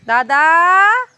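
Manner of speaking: high, loud call to big brother. Real, not acted. Little sister calls her brother as told, loud high call. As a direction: shout for your brother.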